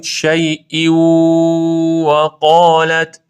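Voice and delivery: very slow, chanted recitation; Quran recitation. Shown as a demonstration. Teacher reciting the phrase slowly for students.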